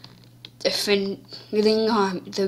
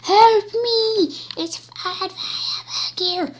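panicked victim; 10 semitones higher, slower than usual. Pretend. Victim voice calling help.

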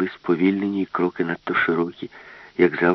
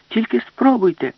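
loud, much higher challenge; defiant taunt; 6 dB louder than usual. Acted. Alice challenges the ship's brain, loud high taunt. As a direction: throw the dare back hard.

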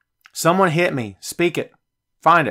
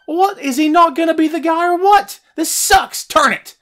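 disgusted fan; 10 semitones higher than usual. Retold or imitated. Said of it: "Even his fans that said".

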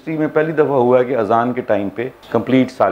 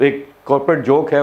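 usual speaking voice

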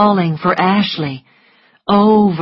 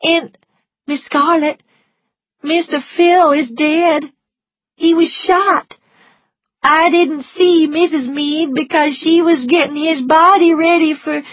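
higher, louder upset servant; anxious maid voice; 11 semitones higher, 5 dB louder than usual. A narrator's reading. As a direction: blurt the bad news. Prissy brings bad news.

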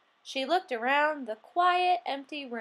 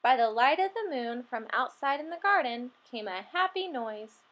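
usual speaking voice

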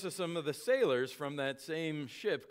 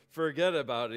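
punchy, louder New York "Forget about it"; hammed-up New York accent; 6 dB louder, faster than usual. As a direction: play the New York tough guy brushing him off. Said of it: "with that New York accent".

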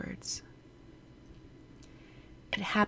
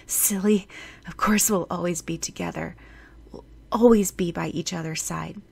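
ordinary delivery

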